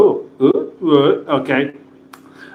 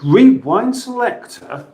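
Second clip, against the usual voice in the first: 'Rewind selector?' pitched higher as a question; 'Rewind selector?' asked higher, unsure it works; puzzled question; 8 semitones higher than usual. Shown as a demonstration. Testing the rewind selector, asks higher and puzzled. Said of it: "let's see if this works".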